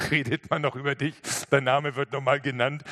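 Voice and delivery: laughing lightly as you speak; amused laughing. Real, not acted. Preacher imagines telling the long-dead chief he's still remembered, amused laughing. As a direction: talk through a chuckle.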